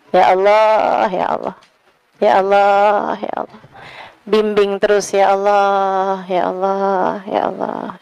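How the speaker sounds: sincere low plea to Allah, trailing into a whisper; whispered prayer. Real, not acted.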